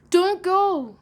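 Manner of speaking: high, loud pleading call; calling in pity. Acted. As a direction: call after him with pity. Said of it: "they called in pity".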